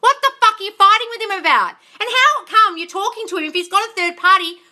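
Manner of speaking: high, heated interrogation; exasperated scolding. Real, not acted. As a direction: demand answers, fed up and high-pitched. Coach scolds exasperated client.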